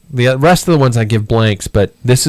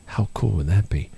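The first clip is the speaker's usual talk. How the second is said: ordinary talk, nothing marked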